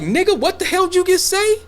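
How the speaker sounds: high, hammed-up outraged comeback; mock angry retort. Jamaican local challenging a clueless visitor, mock outrage, high pitch. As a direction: jump up in pitch like you can't believe your ears. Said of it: "Jamaica may be like".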